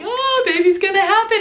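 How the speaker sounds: high, bright excited announcement; excited midwife. Retold or imitated. Midwife walking in, excitedly announcing the birth is near. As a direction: burst in with good news.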